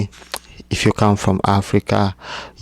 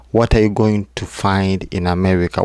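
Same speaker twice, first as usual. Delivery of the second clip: ordinary talk, nothing marked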